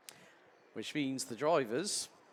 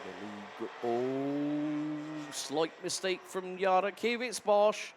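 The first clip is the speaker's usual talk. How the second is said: live play-by-play; 3 semitones higher, 6 dB louder than usual. Real, not acted. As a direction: call every overtake with urgency.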